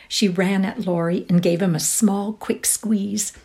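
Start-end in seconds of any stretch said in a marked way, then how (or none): none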